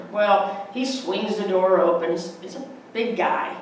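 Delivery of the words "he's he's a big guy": ordinary delivery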